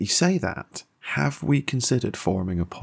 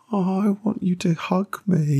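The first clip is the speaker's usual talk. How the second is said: mock longing; 11 semitones higher than usual. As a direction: whine it like a smitten fan. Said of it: "And I'm just like".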